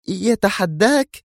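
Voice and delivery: high, slow woman's question; surprised echo. Acted. Zosha echoing Yuri in astonishment, high and slow. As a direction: echo it back amazed. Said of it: "في دهشة".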